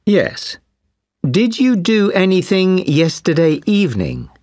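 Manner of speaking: ordinary talk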